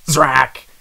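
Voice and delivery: loud, sharp zap sound effect; blaster zap. Acted. Narrator voicing the blaster shot, loud zap. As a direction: spit out a laser blast noise.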